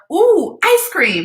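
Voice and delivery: high, slow drawn-out temptation; mock-eager aunt testing the child; teasing. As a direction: dangle the treat playfully. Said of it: "So I said to her".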